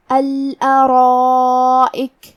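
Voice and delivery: الارائک with the long a held for four beats, very slow; stretched madd. Shown as a demonstration. Teacher shows students the four-count madd in الارائک. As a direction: draw out the a of الارائک for four counts, full and heavy. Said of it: "چار مد کے برابر لمبی کرنا ہے".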